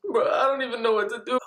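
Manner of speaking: high-pitched mock panic; fake hysterical. Son lying about the branch, acted hysteria. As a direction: wail it in put-on desperation.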